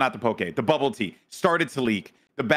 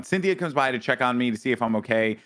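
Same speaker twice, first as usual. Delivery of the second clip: usual speaking voice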